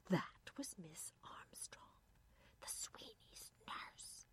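slow, awed whisper; hushed whisper. A narrator's reading. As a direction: whisper it as if overheard. Irene afraid of being overheard.

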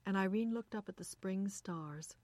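ordinary delivery, nothing marked

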